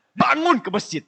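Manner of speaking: high, loud mock yell; wife's wake-up yell. Wife yelling at her sleeping husband to go to the mosque, mock yell. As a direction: bark it like a fed-up wife. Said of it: "digampar sama istri".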